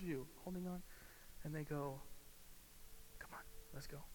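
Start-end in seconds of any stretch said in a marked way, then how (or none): 3.2-4.0 hushed, whispered encouragement; acted rescuer's whisper; whispered urging. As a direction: whisper it to coax someone onward.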